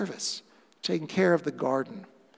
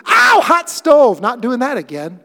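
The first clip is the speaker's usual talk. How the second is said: jokey burnt-hand ouch, high and loud; mock pained yelp; 6 semitones higher, 10 dB louder than usual. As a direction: yelp like you touched a hot stove. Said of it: "You do".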